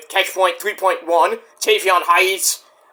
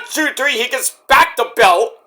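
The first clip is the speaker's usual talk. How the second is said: ordinary talk, nothing marked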